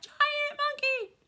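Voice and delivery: very high shriek of terror; high screaming cat. Acted. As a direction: shriek in panic at the monkey. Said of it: "screams the orange cat".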